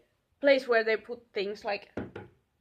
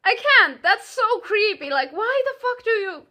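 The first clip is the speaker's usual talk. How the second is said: high, loud disbelieving cry; real exasperation, pitched high and loud; exasperated outburst; 8 semitones higher, 5 dB louder than usual. Real, not acted. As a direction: burst out in exasperation at the creep. Outburst at creepy stare.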